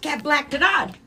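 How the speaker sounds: high, loud backwards phrase; spiced up. Shown as a demonstration. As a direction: punch up the reversed phrase. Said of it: "we'll spice it up".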